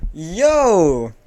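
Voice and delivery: loud, high "yo" of alarm; startled shout. Real, not acted. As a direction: call out in shocked protest. Startled at gun being pulled.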